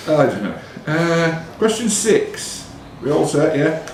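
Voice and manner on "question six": higher, slow, drawn-out question number; quiz question announcement. Acted. Quizmaster to the contestants, slow rising announcement. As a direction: lift and stretch the number like a quizmaster.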